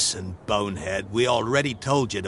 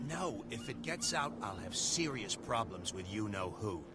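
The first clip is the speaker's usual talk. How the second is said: hushed and much softer, keeping it secret; 14 dB quieter than usual. Acted. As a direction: keep it down, nervous someone hears. Secretive talk near warehouse.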